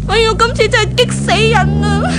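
high, crying wail of jealousy; tearful outburst. Acted. Jealous daughter alone in her room, crying. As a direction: break into tears over the rival.

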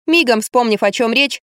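plain reading voice

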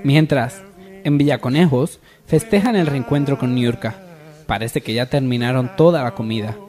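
dramatic soap opera narrator; old radio show. A narrator's reading. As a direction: set the scene like a radio serial. Narrator sets the scene. (narrador de radionovela)

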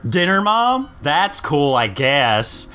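mock-impressed sarcastic child. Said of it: "he's learned sarcasm at four".

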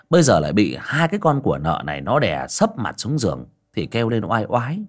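usual speaking voice

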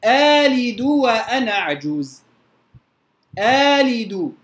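آلد with a lengthened opening aa; natural madd. Shown as a demonstration. Quran teacher demonstrating the natural madd to students. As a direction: draw out the aa of آلد evenly. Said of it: "يبقى على أصل المد اللي هو المد الطبيعي".

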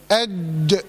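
أج with ج blocked, then released with a bounce; qalqalah on jeem. Shown as a demonstration. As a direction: stop the ج in أج hard, then let it spring. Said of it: "القلقلة، اللي هو الاضطراب في مخرج الحرف".

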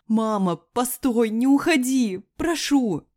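high, loud pleading cry; tearful desperate plea. A narrator's reading. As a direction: beg loudly for someone to stay. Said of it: "Давясь слезами".

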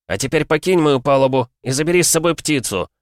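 urgent loud instruction; shouted order. A narrator's reading. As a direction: order the captain off the deck. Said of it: "крикнул он в ответ".

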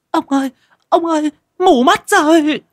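put-on high, pained old wife's cry; a wife calling her husband in pain, going blind. Wife cries to her husband that she is blind, high and pained.

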